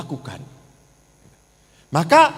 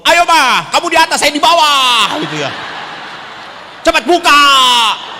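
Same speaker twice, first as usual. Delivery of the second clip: louder, higher brusque commands; loud rough order; 7 semitones higher, 8 dB louder than usual. Retold or imitated. Rough husband loudly orders his wife at night. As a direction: shout it like a drill sergeant.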